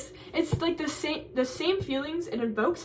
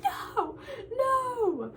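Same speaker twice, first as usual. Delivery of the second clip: laughing denial; 13 semitones higher than usual. Retold or imitated. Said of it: "And I'm like".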